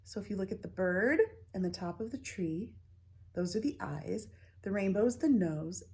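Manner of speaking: ordinary delivery, nothing marked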